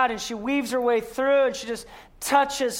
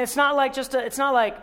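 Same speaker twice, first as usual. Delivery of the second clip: normal talking voice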